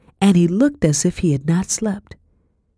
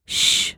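hushing shh. A narrator's reading. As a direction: hush someone with a finger to the lips. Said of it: "putting her finger to her lips".

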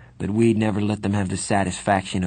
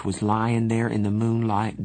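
usual speaking voice

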